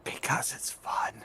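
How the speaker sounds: playful whisper. Real, not acted. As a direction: whisper it gleefully. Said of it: "Why are we whispering?".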